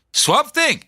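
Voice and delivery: high-pitched, badly faked South African accent. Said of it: "I can't do the accent".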